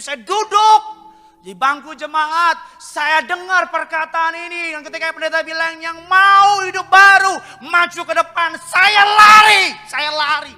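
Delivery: loud, high, impassioned shouted testimony; a preacher crying out his conversion story, fully shouted; preaching at full force. Acted. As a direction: pour it out at the top of your voice. Sermon climax, shouted testimony.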